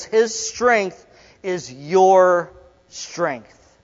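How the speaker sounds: drawn out, every word weighted; slow it right down to land the point; preacher hammering the key line; slow emphatic. Acted. Emphatic sermon climax.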